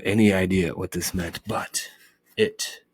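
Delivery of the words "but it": usual speaking voice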